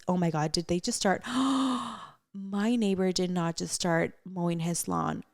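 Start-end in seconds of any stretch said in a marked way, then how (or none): 1.3-2.0 quick startled intake of breath; sharp gasp. Real, not acted. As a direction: gasp at the sudden noise. Neighbor suddenly starts mowing.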